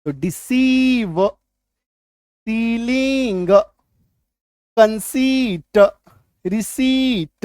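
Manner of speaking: डिसीव, सीलिंग, कंसीट, रिसीट very slow, the ई stretched; teacher stretching the ई in सीलिंग and रिसीट; long ई sound. Shown as a demonstration. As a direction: hold the "ee" long in each word, like रिसीट. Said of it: "सिर्फ बड़ी इकार की मात्रा रह जाएगी".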